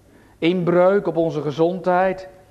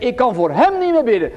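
quoted excuse; 5 semitones higher, 4 dB louder than usual. Pretend. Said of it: "Als je zegt".

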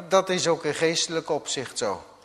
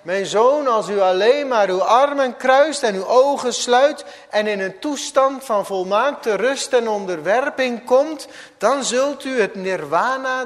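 higher, louder calm preaching; serene Buddha; 8 semitones higher, 9 dB louder than usual. Acted. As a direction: announce nirvana from above. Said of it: "Toen keek Boeddha over de rand".

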